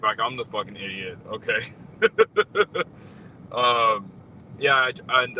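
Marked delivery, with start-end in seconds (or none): 1.8-3.5 high-pitched disbelieving laugh; high incredulous laugh. Real, not acted. Laughing at own frustration.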